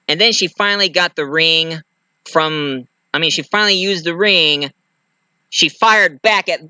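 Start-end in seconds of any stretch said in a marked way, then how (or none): none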